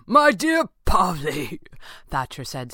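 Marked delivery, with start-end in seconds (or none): 0.1-1.6 loud, slow words through laughter; raised, laughing villain voice; convulsed with laughter. A narrator's reading. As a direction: laugh while addressing him grandly. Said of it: "still convulsing".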